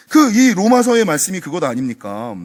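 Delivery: normal talking voice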